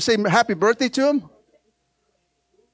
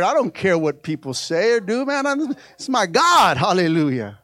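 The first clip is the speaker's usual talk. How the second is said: usual speaking voice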